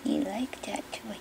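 whispered. Real, not acted. Owner whispering to the puppy. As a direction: hush the words.

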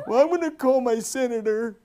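put-on high, whining grown man like a toddler; mocking a lazy worker as a whiny baby. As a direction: whine it like a big baby. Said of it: "Forty-year-old babies".

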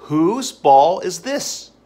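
slow, loud and high, rising at the end; slow loud drill. Shown as a demonstration. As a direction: stretch each word for beginners. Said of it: "flashcard drill demo".

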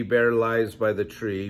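ordinary delivery, nothing marked

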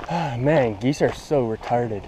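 ordinary talk, nothing marked

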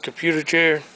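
ordinary talk

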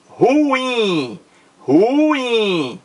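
"Ruim" drawn out as Ru-iiim; stressed syllable stretched. Shown as a demonstration. Teacher showing students the strong syllable, "Ruim" drawn out on "im". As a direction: stretch "Ruim", leaning long on the final "im". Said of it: "A sílaba mais forte aqui".

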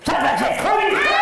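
high, loud startling yell; sudden shout. Acted. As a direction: jump out and yell.

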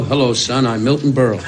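lightly voiced pompous old comedian; Milton Berle. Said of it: "come up to me and go".

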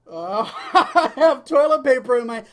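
loud, high, slow laughing panic; embarrassed laugh. Retold or imitated. Caught in line with toilet paper, loud embarrassed laughing.

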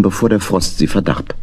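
usual speaking voice

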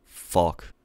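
ordinary delivery

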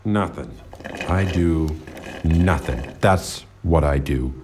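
very low, halting deadpan; low, heavy, grudging answer; sullen resignation. Acted. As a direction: drop deep and space the words out bleakly.

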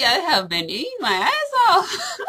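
laughing through the words. Real, not acted. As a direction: confess it while cracking up. Laughing confession.

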